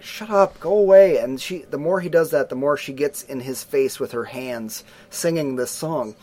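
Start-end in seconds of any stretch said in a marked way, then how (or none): 0.0-1.2 higher, louder shout to go away; annoyed boy. Retold or imitated. Sleepy brother yelling at his singing sister, mock yell. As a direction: push her off, fed up. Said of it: "he's like".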